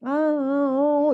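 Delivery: 높고 아주 느린 아기 소리; 자기 소리 따라 하는 아기처럼; baby repeating sounds. Shown as a demonstration. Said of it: "이런 소리를 내요". (아기 소리 흉내)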